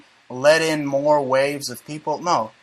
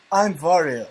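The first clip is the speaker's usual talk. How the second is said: fighter's line in a put-on Russian accent; heavy Russian-accented read. Host reads a biker's quote aloud, Russian accent. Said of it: "do it in a Russian accent".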